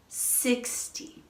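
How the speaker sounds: "sixty" stressed on "six", soft "ty". Shown as a demonstration. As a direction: lean on the first syllable of "sixty". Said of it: "stress is on the first syllable".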